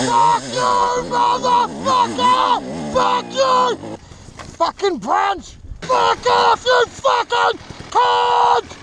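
high shouted curses; swearing tirade. Real, not acted. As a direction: yell every curse out, strained. Shouting curses while struggling.

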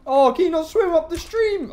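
high, loud mock sympathy; mocking taunt. Real, not acted. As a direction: tease it as it flounders. Mocking fleeing animal.

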